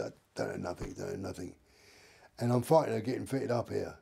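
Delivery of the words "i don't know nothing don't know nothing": low, soft stonewalling; mumbled denial. Retold or imitated. Suspect questioned at the police station, low muttered denial. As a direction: play dumb in a low mumble.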